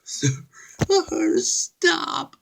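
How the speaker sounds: high, frightened begging; pleading. Acted. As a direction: beg him to stop. Passenger begs driver.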